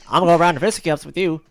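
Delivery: hammed-up challenge to a fistfight; old-timey tough. Challenging the villain in the mock cartoon, hammed-up.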